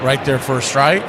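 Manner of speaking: play-by-play. Acted. Calling the game play-by-play.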